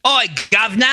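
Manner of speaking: high, cheeky cockney call; mock cockney greeting. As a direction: shout it like a London street lad. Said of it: "He probably said governor".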